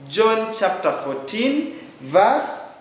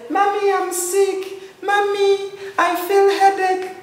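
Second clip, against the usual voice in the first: put-on high whiny child's voice, much higher; whiny sick child; 13 semitones higher than usual. Child whining to mommy that they are sick. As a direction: whine like a sick kid to mommy. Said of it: "the Father does not speak like that".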